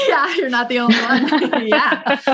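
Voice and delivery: loud, high laughing reply; laughing, teasing sympathy. Real, not acted. Laughs at host's cry remark.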